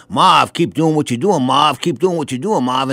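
imitated cornermen in a Massachusetts accent; lightly put-on Massachusetts accent, dropping the R in Marv. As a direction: do Boston-area trainers with a Massachusetts accent. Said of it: "in that Massachusetts accent".

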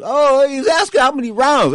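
you can't believe he's asking; amazed. Real, not acted. Marvels at Duran's eagerness.

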